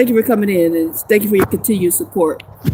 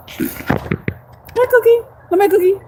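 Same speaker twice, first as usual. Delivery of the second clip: dog-talk voice; 11 semitones higher, slower than usual. Real, not acted. Calling the dog sweetly.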